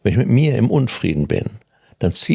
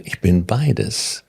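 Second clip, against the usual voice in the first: slow affirmation; much slower than usual. Shown as a demonstration. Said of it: "slow affirmation".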